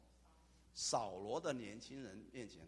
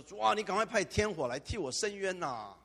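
a hammed-up cry for fire from heaven, a touch louder and higher; mock vengeful plea; 7 semitones higher, 7 dB louder than usual. Preacher voices the wrong version of Stephen, a mock vengeful cry. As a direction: play a wrong, vengeful Stephen, half in jest. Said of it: "斯蒂凡说".

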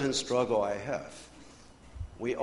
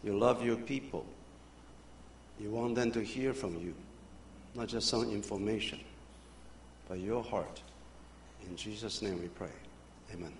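soft, hushed prayer to God; quiet prayer; 3 semitones lower, 11 dB quieter than usual. Acted. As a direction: pray gently, voice dropping. Pastor opens with a prayer.

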